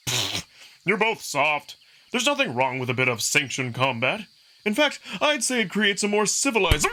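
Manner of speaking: brash wolf fighter inviting punches; cocky young wolf. Acted. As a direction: egg him on with a grin. Amicus scoffs at both.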